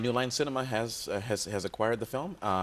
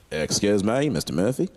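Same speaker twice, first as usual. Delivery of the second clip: Australian fan; 3 semitones lower, 6 dB louder than usual. Retold or imitated. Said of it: "The guy goes".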